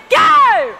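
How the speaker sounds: high, loud shouted cue; shouted command. Real, not acted. Instructor sets the audience off once again, shouted command. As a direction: shout the start to a whole group.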